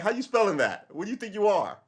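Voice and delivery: high, loud incredulous challenge; mock outrage. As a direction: demand an explanation in exaggerated disbelief. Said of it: "I'm like".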